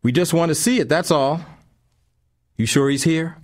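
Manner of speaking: high, loud, slurred street tough; slurred thug. A narrator's reading. Intruder questioning the empty house, slurred and loud. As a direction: slur it like a drunk intruder. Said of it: "The voice was slurred".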